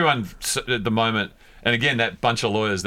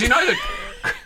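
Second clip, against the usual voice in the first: laughing; much slower than usual. Real, not acted. Laughing mid-sentence about rivals.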